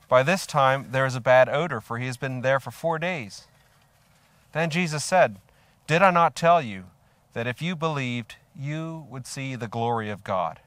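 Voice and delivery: loud, emphatic scripture reading. A narrator's reading. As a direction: project the verse, then settle as it ends. Reads scripture aloud to congregation.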